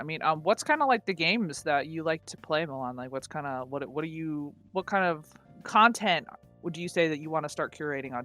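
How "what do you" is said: ordinary delivery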